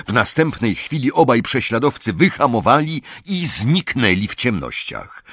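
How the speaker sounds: ordinary delivery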